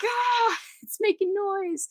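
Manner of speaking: high, squeaky startled yelp with a laugh; mock startled cry. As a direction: play being jolted awake by a noisy toy. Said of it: "you'd be like".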